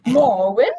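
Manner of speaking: can't believe it's her; disbelieving call of her name; incredulous. A narrator's reading. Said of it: "Telemain's incredulous voice".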